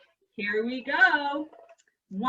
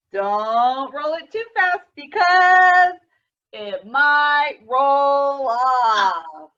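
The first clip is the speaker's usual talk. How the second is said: slow, louder, sung-out silly warning; joking sing-song; 3 semitones higher, 12 dB louder, much slower than usual. Acted. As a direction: tease the kids with a mock warning. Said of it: "Just kidding".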